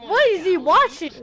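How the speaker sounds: loud, high question; surprised. Real, not acted. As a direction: ask in sudden surprise. Surprised at neighbor.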